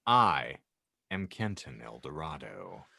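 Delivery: ordinary talk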